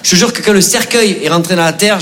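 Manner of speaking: ordinary delivery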